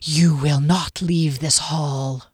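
low, slow ghostly threat; slow, ominous and deep; menacing hiss. A narrator's reading. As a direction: pronounce doom as a sentinel. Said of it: "The voice hissed".

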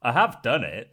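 ordinary talk

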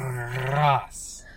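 mock Scottish with a heavily rolled R; bad Scottish accent. Co-host finishing a wrestler's name in a bad Scottish accent. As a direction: put on a hammy Scottish burr. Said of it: "That's my Scottish accent coming in".

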